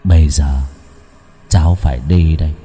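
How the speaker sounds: low, measured, eerie voice; slow, distinct words. A narrator's reading. Possessed Lợi answers Mr Hải, slow and low. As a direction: draw out each phrase deep and deliberate. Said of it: "Vẫn là kiểu nói chậm rãi, rành rọt từng tiếng".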